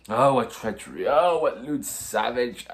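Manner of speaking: slow, drawn-out stage wail on the second cry; theatrical lament. Acted. Prince to his doctor, mourning in a theatrical lament. Hamlet laments father's murder.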